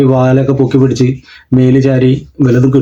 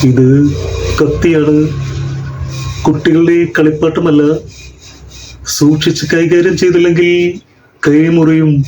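film hero's halting, deliberate warning; Jayan. Shown as a demonstration. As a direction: deliver it in weighty pieces with pauses. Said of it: "ജയന്റെ ശബ്ദം ഒന്ന് ശ്രമിച്ചു".

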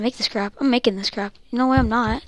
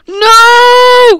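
shout; 10 semitones higher, 8 dB louder than usual. Real, not acted. Shout at death.

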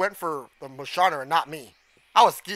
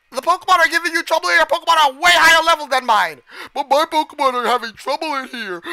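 higher, hurried mock whine; mocking complaint; 8 semitones higher, 5 dB louder than usual. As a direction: imitate a helpless complainer, derisively. Mocking game character's complaint.

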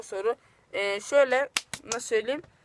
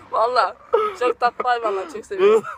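breaking into high laughter; laughing; 7 semitones higher than usual. Real, not acted. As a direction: talk through helpless giggles. Helpless laughter over panda answer.

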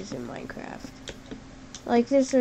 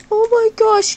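much higher, louder delighted squeal; excited gasp and shout; 10 semitones higher, 10 dB louder than usual. Real, not acted. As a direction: gush over the cute foxes. Seeing the cute foxes.

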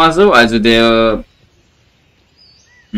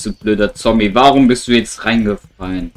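usual speaking voice

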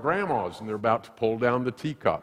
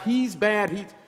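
whiny mom; 11 semitones higher, slower than usual. Retold or imitated. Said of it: "she was just always like".

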